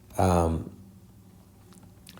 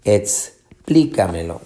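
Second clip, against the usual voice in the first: explícamelo slow, pause after ex, PLÍ raised; stress on PLÍ; 2 semitones lower, slower than usual. Shown as a demonstration. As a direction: split off ex, then hit PLÍ in explícamelo. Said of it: "vas a tener la fuerza de pronunciación". (explícamelo lento, pausa y acento en PLÍ)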